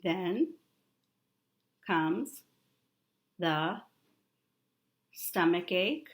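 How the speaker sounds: each word alone, long pauses between; slow word-by-word reading for a child. Shown as a demonstration. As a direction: point and read one word at a time. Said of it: "You read it".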